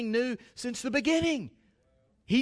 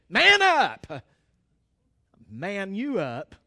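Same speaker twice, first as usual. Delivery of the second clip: punchy call; 4 semitones lower, slower than usual. Pretend. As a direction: bark it out like a coach cheering. Repeating the encouragement.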